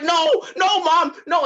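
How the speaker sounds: high, loud pleading; mock panicked cry. Son climbing away from his mom, hammed-up panicked pleading. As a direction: yell it like a kid begging his mother.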